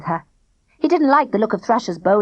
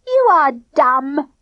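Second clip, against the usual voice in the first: slow, high teasing grin; boy grinning at his slow friend; 5 semitones higher, 4 dB louder, slower than usual. A narrator's reading. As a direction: tease him, grinning. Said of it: "Denzel grinned".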